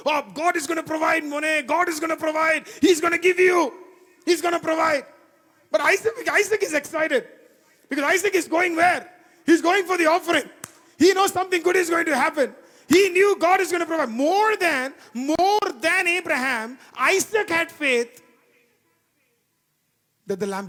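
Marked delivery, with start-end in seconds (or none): 0.1-5.1 high, loud, exultant declaration; excited proclaiming. Acted. 5.7-18.2 high, loud fervent preaching; preaching at full force. Acted.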